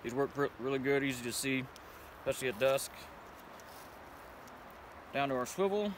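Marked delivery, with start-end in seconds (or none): none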